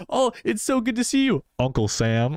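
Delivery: high, sugary mock greeting; gushing fake greeting. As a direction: gush it like a fake welcome. Gushing quote, high pitch.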